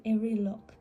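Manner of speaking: usual speaking voice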